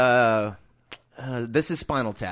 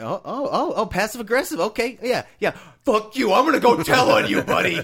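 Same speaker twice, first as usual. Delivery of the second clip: high, rising mock outrage that gets louder; mock passive aggressive; 7 semitones higher, 4 dB louder than usual. Mocking a teen who ignores you, higher and louder. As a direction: ham up the petty threat to tell on them. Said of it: "And you're like".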